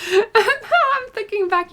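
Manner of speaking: high laughing voice. Real, not acted. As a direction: laugh as you start the line. Laughing at her own story.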